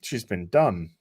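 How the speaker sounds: hushed realisation. Retold or imitated. Viewer realising the maid has been brainwashed, hushed. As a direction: murmur it as the penny drops. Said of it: "it's just kind of like".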